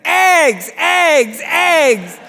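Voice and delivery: high, loud, hammed-up panic; panicked cries. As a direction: shriek for the eggs in mock panic. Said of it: "Edie is getting panicked".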